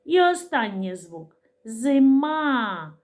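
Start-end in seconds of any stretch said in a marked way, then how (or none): 1.6-2.9 "Зима" with a long final А, higher; drawn-out teaching "Зима", lingering on А; sounding out. Shown as a demonstration. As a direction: stretch the last vowel of "Зима". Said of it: "Звук А".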